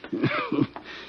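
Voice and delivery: breathy rueful chuckle; weak wheezy laugh. Acted. Dying inmate laughing weakly at his own fate.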